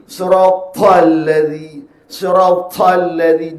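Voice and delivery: siratal chanted slowly, the ta held back and heavy; tajwid recitation. Shown as a demonstration. Teacher demonstrating siratal with a held ta. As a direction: recite siratal with the ta trapped, not released. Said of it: "Dia tak terlepas suara".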